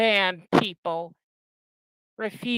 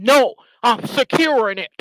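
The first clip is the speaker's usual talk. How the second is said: mock snippy retort, louder; retold snap; 3 semitones higher, 6 dB louder than usual. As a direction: bite it off like an angry man caught out. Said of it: "snapped at me and said".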